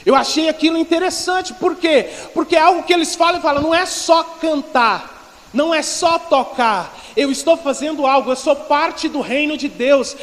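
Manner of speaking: loud, high-pitched preacher's delivery; fervent preaching. Real, not acted. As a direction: drive each phrase home to the congregation. Preaches at full force.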